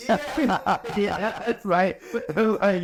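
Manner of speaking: real laugh through the words, pitched high. Real, not acted. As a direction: agree while chuckling, voice lifted high. Laughs at compliment on his looks.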